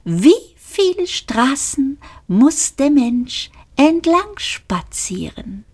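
lightly voiced, high and slow question; a lightly pitched character, drawn out slow. A narrator's reading. A character asks the great question, lightly voiced high.

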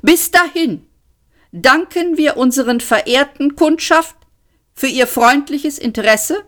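courteous voice turning openly threatening; sharper menace. A narrator's reading. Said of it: "Der drohende Unterton in der Stimme wurde schärfer".

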